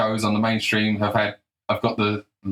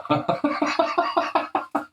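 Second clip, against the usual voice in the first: high laugh. Real, not acted. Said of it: "I don't know why I'm laughing".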